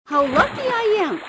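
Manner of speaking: high, happy cry of relief; delighted squeak. Acted. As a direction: squeak with joy at the shelter. Shrimp glad to find a cave.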